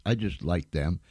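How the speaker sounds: normal talking voice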